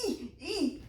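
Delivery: high, soft eek noises; little squeaky strain. Retold or imitated. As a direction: squeak out two small efforts. Straining on skis, acted.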